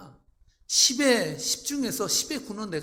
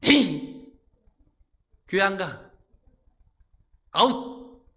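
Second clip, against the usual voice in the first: acted blow-up, each phrase thrown out; mock temper tantrum; 2 semitones higher, 12 dB louder, much slower than usual. Pastor losing temper before the congregation, mock tantrum. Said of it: "목사님이 성질을 내?".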